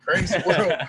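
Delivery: high, loud deadpan quip; comic punchline voice. Retold or imitated. The guy sipping the Heineken, loud mock quip. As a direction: deliver the joke's punchline big. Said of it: "He's like".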